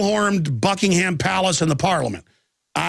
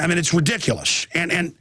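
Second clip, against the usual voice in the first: ordinary talk, nothing marked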